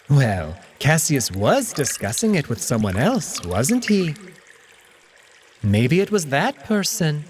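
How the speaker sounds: a hint of a wary young cat questioning; Alex, cat character. Alex's voice in the story.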